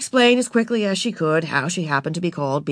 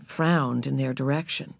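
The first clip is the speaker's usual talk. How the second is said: plain reading voice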